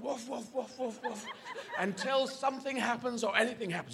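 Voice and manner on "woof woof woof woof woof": softer, high, steady woofs; dog woofing on. Acted. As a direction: keep barking away in the background. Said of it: "the dog carries on woofing".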